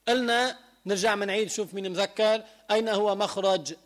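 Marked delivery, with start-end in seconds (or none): none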